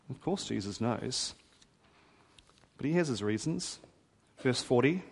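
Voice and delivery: normal talking voice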